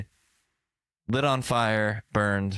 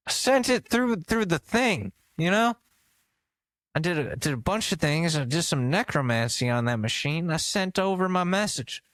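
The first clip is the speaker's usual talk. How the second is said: put-on lightly higher old-man voice, pleased; mock Biden bragging about tech; proud old Biden; 6 semitones higher than usual. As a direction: boast like a grandpa with a fax. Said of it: "It's just Joe Biden".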